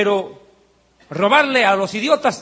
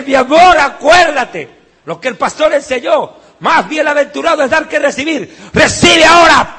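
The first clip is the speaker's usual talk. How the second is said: mock preacher shouting; 7 semitones higher, 9 dB louder than usual. Mock wife imparting anointing.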